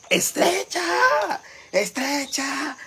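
put-on high, long-drawn drunken call; imitated drunken yell. Drunk man calling at her door, imitated drawn-out yell. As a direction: holler it like a drunk, stretching the vowels. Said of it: "un hombre briaguísimo a la puerta de mi casa gritándome".

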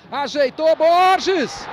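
rising roar of excitement; goal shout. Real, not acted. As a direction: yell the name as it goes in. (grito de gol)

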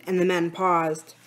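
usual speaking voice

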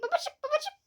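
high, squawky parrot imitation. Shown as a demonstration. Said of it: "Papağan sesiyle babacık de".

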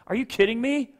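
loud, high, incredulous tirade; acted furious scolding, shouted in disbelief; harsh berating pastor. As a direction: blow up at someone who came for help. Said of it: "Just hearing me say it like this".

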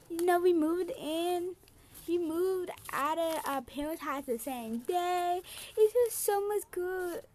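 excited daughter phoning home; play-acted happy daughter. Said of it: "And she gonna be".

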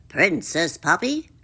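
croaky, courtly frog voice; froggy character voice; croaking frog. A narrator's reading. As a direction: address the princess in a frog's croak. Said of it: "he croaked".

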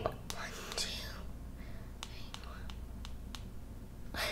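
very soft whispered count; barely audible private tally; counting under breath. Real, not acted. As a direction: whisper the numbers to yourself. Counting topics under her breath.

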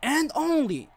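booming ring announcer intro; arena announcer. Acted. Streamer announcing fighters to the crowd, ring announcer. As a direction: call it out like a wrestling emcee.

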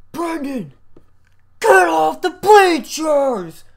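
put-on stretched-out yell at a kid on the bleachers; gym teacher yelling. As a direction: bellow it across the gym like a fed-up coach. Gym teacher calling out across gym.

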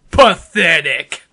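ordinary delivery

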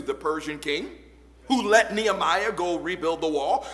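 ordinary talk, nothing marked

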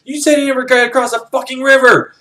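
high-pitched acted outrage; outraged yell. Villain berating his second in command, outraged yell. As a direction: berate a subordinate in a mock panicked yell.

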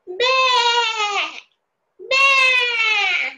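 high, slow, loud bleating like a goat. Shown as a demonstration. Teacher bleating like a goat for children to guess, high and long. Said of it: "दुसरा आवाज मी तुम्हाला ऐकवते ना".

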